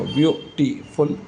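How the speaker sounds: Beautiful broken into three slow, separate beats; slow, segmented beautiful; syllable by syllable. Shown as a demonstration. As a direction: count out the three syllables of beautiful with small gaps. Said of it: "तीन syllable word".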